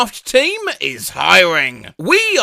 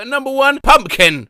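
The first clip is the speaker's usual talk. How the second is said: normal talking voice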